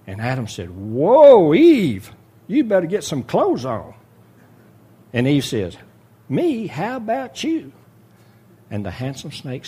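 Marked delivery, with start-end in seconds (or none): 0.7-3.9 loud, high, shocked exclamation; a sudden jolt of alarm at what he sees; startled husband. Acted. Adam shocked at naked Eve, loud startled cry. 6.3-7.7 high, offended comeback, slower on the end; mock outrage thrown back at him; indignant retort. Eve snapping back at Adam, high and indignant.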